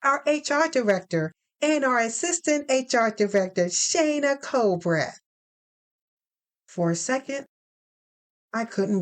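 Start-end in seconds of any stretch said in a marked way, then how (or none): 0.0-5.2 higher, bright professional phone voice; HR woman on the phone. Acted. Lynn from HR announcing finalist news, higher brisk phone voice. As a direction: sell the good news like a cheerful recruiter.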